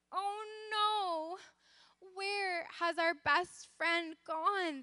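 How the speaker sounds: tearful cry. A narrator's reading. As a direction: wail it gently as a worried story character. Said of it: "they started to cry".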